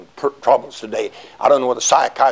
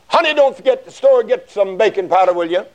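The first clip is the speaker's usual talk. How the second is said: mild hollering; 7 semitones higher than usual. Retold or imitated. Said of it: "she's hollering".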